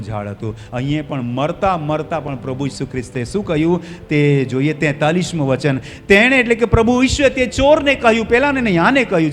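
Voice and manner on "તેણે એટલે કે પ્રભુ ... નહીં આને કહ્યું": high, loud, insistent telling; full-force preaching. Real, not acted. Preacher stresses which thief Jesus addressed, full-force preaching. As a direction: drive each phrase home loudly.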